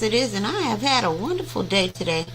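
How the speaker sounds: ordinary delivery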